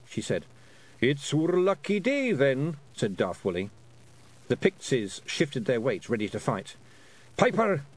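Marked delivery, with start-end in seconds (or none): 1.0-2.8 high, drawn-out Scottish pixie; Daft Woolly. Acted. Pixie welcoming many-headed monsters, gleeful Scots. 7.4-7.8 high Scottish pixie calling out; Rob Anybody. Acted. Pixie leader calling his piper, commanding Scots. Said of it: "said Rob Anybody to William".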